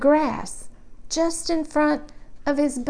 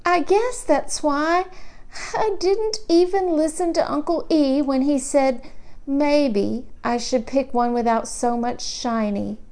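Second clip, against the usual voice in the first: usual speaking voice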